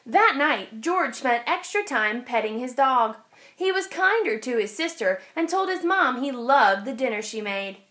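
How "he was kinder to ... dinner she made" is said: ordinary delivery, nothing marked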